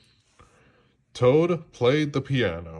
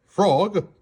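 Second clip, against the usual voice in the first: high, loud call of a name; cried out; 8 semitones higher, 5 dB louder than usual. A narrator's reading. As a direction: call out proudly. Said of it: "cried Toad".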